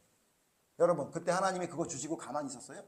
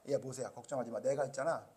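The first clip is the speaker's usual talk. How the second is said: reassuring God; 4 semitones lower, 7 dB quieter than usual. Retold or imitated. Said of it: "막 짜증을 내니까".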